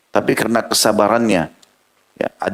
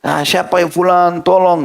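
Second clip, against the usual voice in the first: mock calling out; 6 semitones higher, slower than usual. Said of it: "Teriak-teriak".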